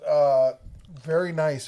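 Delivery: ordinary talk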